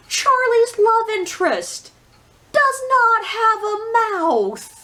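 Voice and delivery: high, slow, drawn-out disbelief; incredulous. Real, not acted. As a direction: stretch it out in exasperated disbelief. Incredulous complaint about the heroine.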